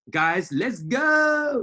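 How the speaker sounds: high, loud, drawn-out rallying shout; imitating a trader's rousing catchphrase; Millie Mills impression. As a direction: hype up the traders with a stretched-out call. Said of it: "You know, in her accent".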